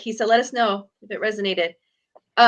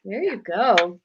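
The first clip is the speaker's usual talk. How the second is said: normal talking voice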